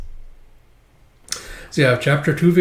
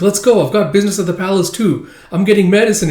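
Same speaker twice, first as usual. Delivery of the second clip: brisk eager command; 7 semitones higher, 5 dB louder, faster than usual. Acted. Girl drags him along.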